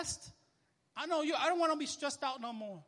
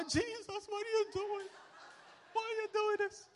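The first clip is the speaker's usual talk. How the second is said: pleading wife; 10 semitones higher than usual. Retold or imitated. Wife in the car pleading with the angry driver. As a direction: play the shocked wife begging him to stop.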